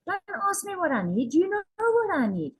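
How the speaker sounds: put-on high, sassy little-girl voice; a cheeky six-year-old talking back; cheeky inner child. Her cheeky inner child refusing to answer, sassy. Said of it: "flippin' cheeky".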